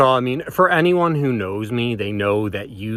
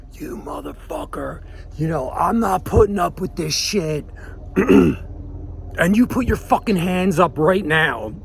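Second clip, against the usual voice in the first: high, strained mock yell at normal volume; retold screaming; 7 semitones higher than usual. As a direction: imitate screaming in his face, held back. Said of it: "just screaming in his face".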